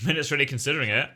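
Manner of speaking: ordinary delivery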